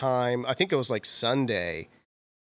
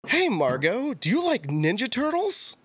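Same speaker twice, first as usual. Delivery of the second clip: put-on high-pitched, bouncy greeting to a child; Brian Tochi; 10 semitones higher than usual. Actor asking a fan's six-year-old daughter, high-pitched and eager. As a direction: pitch it way up, questioning a little kid. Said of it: "he stands up and he goes".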